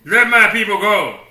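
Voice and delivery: loud, commanding, forceful demand; with authority. Shown as a demonstration. Moses demanding Pharaoh's obedience, booming with authority. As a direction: command it like a prophet. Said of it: "You think he said it with authority?".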